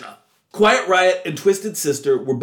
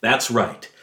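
usual speaking voice

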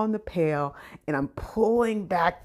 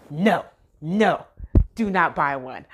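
firm, louder no no; mild urgent warning; 7 dB louder than usual. Retold or imitated. Store worker warns shopper not to buy one, firm and louder. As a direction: warn her off buying one.

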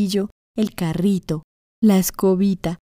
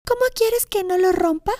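put-on much higher, little girl listing woes; little girl's voice; 12 semitones higher than usual. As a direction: recite it all in a high small voice. Girl recites the chain.

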